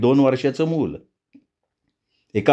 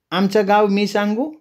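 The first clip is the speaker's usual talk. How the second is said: put-on much higher child's voice, eager; 8 semitones higher than usual. The little boy offers to tell the village name, high and eager.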